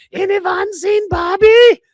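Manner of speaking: very high, loud, slow squeaky shout; cartoon toilet scrubber calling out for Bobby. Toilet scrubber character speaks.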